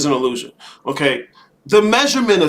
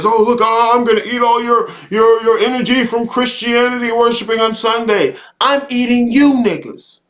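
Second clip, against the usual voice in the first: higher-pitched mocking voice; mocking quote; 4 semitones higher than usual. Speaker mocking those who feed on Sunday worship, high mocking quote. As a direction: mimic their eager boast, pitched up.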